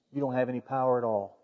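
a hushed, steady answer to Pilate; Jesus calmly correcting Pilate, hushed; quiet, firm reply. Retold or imitated. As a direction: say it low and settled, no force.

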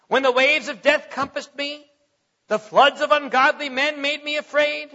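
loud, high, slowed preaching cadence; preaching David's song to the congregation; fervent scripture reading. Acted. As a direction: proclaim the psalm with rising fervor.

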